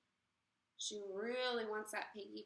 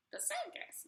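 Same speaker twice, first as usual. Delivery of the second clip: high whispered question; whispered doubt; 3 dB quieter than usual. Acted. Witch doubting own idea.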